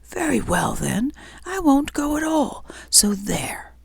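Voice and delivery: petulant snap, rising high; snapping. Acted. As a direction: snap it out like a sulky woman. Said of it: "snapped out".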